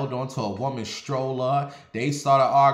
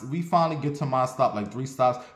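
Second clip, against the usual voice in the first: ordinary talk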